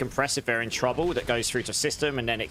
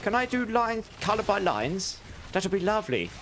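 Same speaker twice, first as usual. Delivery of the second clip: put-on much higher, plummy posh British accent of a doting nan; 9 semitones higher than usual. Nan asking to colour in grandson's half-done tattoo, posh British accent. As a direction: imitate nan cooing in a Queen-like British accent. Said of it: "that's exactly how she spoke".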